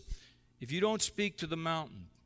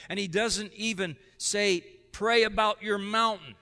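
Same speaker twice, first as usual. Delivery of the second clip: preaching loud; 7 semitones higher, 8 dB louder than usual. Real, not acted. Preacher contrasting what Jesus said.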